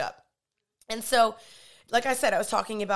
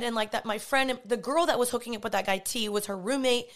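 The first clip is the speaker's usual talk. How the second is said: ordinary talk, nothing marked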